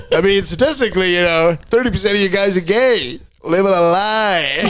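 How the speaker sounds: high, giggling delivery; retelling the bit while cracking up; laughing through it. Retold or imitated. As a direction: try to keep a straight face and fail. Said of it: "he starts going like".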